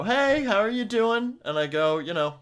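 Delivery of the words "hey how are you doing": high, slow sing-song greeting; customer greeting. Retold or imitated. Mimicking a customer's cheery hello at the register, sing-song. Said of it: "they go".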